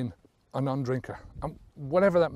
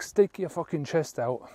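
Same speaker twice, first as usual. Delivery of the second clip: normal talking voice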